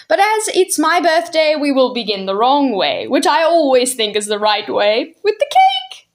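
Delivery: put-on high, merry old man, pleased with himself; jolly old gentleman. Mister Wig explaining tea to Jane and Michael, merry. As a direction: play the host with a twinkle.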